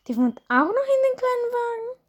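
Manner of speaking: mother can't believe it; incredulous mother. Acted.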